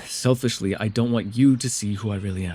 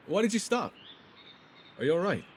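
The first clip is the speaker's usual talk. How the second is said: sudden higher, softer worried questions; startled concern; 7 semitones higher, 7 dB quieter than usual. Acted. Noticing the companion has gone quiet, startled and worried. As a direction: catch yourself, alarmed she's hurt.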